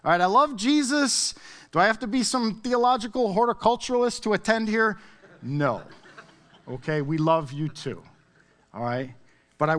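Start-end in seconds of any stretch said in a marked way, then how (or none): 0.0-5.0 high, loud mock protest; imagined baffled congregant. Congregant complaining to Mike about the theology talk, hammed-up bafflement. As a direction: voice a puzzled listener, pitched up and loud. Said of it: "Maybe you're like".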